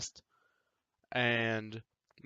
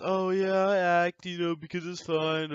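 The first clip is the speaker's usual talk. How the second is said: mock airy quote; 5 semitones higher, 4 dB louder than usual. Mocking shallow actors.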